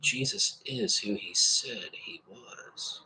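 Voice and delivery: slow hushed whisper of awe; awed whisper. Shown as a demonstration. Preacher voicing a viewer's awe, slow whisper. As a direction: let it out as a breathless realisation. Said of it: "You were thinking".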